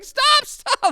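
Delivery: high, loud panicked stop; panicked cry. Retold or imitated. Boy pleading with his attacker, high panicked cry. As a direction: yell it while being punched. Boy being tackled cries out.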